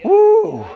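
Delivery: high, loud "woo"; high exultant whoop; excited whoop. Real, not acted. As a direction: whoop with delight at the point just made. Preacher whoops after his point.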